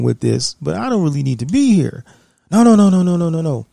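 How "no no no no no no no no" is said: hasty backpedal. Retold or imitated. Recruiter backpedals hastily.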